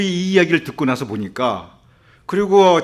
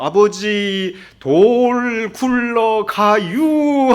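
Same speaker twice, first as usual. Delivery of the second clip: comically drawn-out Chungcheong drawl, higher pitch; slow Chungcheong accent; 3 semitones higher, much slower than usual. Retold or imitated. Chungcheong child warning father of a rolling rock, very slow dialect drawl. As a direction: stretch every syllable like a slow-talking Chungcheong local. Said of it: "충청도 분들이 말씀도 느리고".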